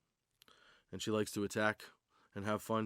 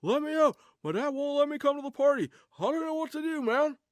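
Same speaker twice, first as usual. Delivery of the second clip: high, loud pleading dog voice; whiny dog voice; 6 dB louder than usual. Pretend. The dog begging at the door, high whiny voice. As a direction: beg to be let out like a pup.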